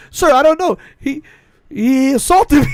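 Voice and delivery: loud, high protest of innocence, hammed up; mock innocent victim. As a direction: plead your case to a cop, comically. Acting innocent to the cop.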